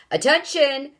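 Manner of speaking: loud booming announcement. Acted. Space Control booming at the hotel, loud booming call. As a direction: boom it out over a loudspeaker. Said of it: "boomed the voice".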